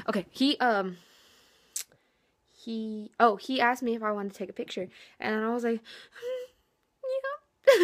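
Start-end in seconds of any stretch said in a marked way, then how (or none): 6.1-7.8 a high, squeaky put-on hum; a squeaky, pretend-bashful hmm; squeaky mock hmm. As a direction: play it coy with a squeaky hmm. Mock thinking over a photo request.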